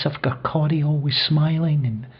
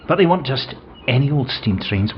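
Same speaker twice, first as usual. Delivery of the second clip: ordinary talk, nothing marked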